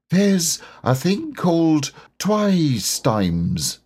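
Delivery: a simple bear, speaking slowly; Pooh voice. A narrator's reading.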